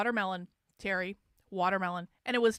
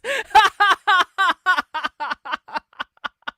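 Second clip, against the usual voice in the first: loud, high laughter, gradually petering out; real belly laugh that runs on and fades; long cackling laugh; 8 semitones higher, 11 dB louder than usual. Real, not acted. Streamer cracking up at a viewer's joke, long laugh.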